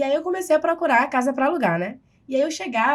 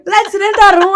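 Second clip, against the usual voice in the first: high, loud laughing mock Maltese voice; Maltese accent; 8 semitones higher, 11 dB louder, slower than usual. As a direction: shout it back like a Maltese local. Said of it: "Aí você". (imitando um maltês que repete alto, rindo)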